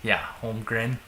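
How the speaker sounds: Minnesota accent slipping out on the name. Real, not acted. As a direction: let the Minnesota accent show. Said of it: "That makes my Minnesota accent really come out".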